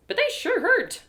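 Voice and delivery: ordinary delivery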